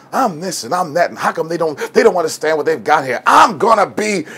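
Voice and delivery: put-on a cocky kid complaining nobody gets him; arrogant student rant. Egotistical student ranting to seamstress.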